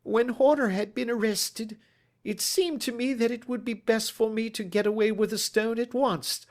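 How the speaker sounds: high, strained confession voice; Ryder's nervous voice. Acted. Broken thief confessing to the detective, strained and high.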